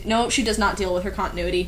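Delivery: usual speaking voice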